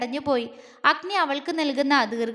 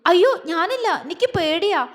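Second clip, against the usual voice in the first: scared protest; 5 semitones higher, 3 dB louder than usual. Acted. Scared of the water.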